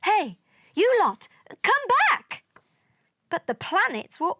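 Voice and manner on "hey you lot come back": loud, high call. A narrator's reading. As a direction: call after a group running off. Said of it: "the sun called".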